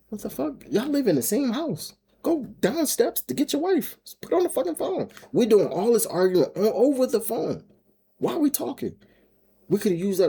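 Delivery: very high-pitched, incredulous rant; exasperated venting. Real, not acted. Venting about the phone argument.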